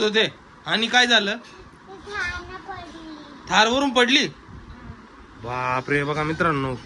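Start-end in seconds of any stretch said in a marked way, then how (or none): 5.4-6.7 low, hushed gasp of dismay; mock shock. Showing viewers the injured girl, low hushed dismay. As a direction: sink the voice low in mock alarm. Hurt child shown to viewers.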